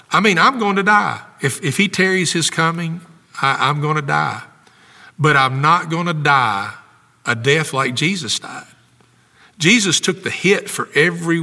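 impassioned preacher driving the point home; fervent preaching. Real, not acted. Pastor telling the congregation Jesus took the hit, fervent preaching. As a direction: preach it with urgent, emphatic conviction.

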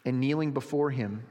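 ordinary delivery

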